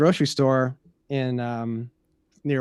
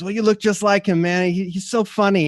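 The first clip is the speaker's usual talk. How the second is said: high, loud, eager insistence; imitated gushing fan; 8 semitones higher, 5 dB louder than usual. Stranger raves about the lookalike comedian, excited gushing.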